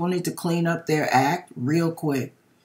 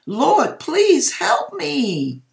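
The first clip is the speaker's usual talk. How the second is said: imitated plea; 11 semitones higher, 4 dB louder, much slower than usual. Pleading to the Lord in dream.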